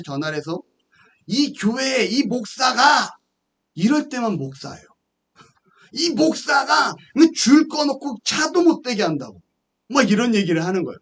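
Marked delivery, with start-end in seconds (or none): 1.3-3.2 drawn-out, high mock accusation; retold grievance, slow and raised; indignant complaint. Acted complaint to building owner. 5.9-9.4 high, emphatic mock accusation; retold grievance, pitched well up; indignant complaint. Acted complaint to building owner.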